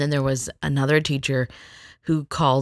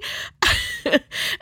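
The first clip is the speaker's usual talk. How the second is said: high laugh. Real, not acted. Laughing at the story.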